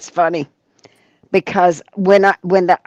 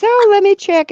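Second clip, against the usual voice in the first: high, louder put-on nurse voice; imitated nurse; 14 semitones higher, 5 dB louder than usual. Nurse to labouring mother, high imitation. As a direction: mimic the nurse in a raised singsong pitch.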